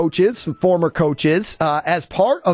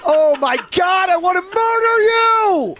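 furious shout; 13 semitones higher than usual. Real, not acted. Host yells at producers through a dead line, furious. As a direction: yell it in pure exasperation, voice cracking high.

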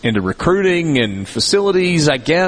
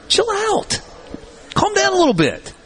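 high mock soothing. Mock calming the worried fans.

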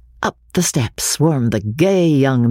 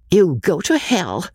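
higher-pitched retort without anger; ready repartee; 6 semitones higher than usual. A narrator's reading. Lady named Blanche answers from the table's end, unangered repartee. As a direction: toss back a choice bit of repartee. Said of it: "though without anger, rather in the manner".

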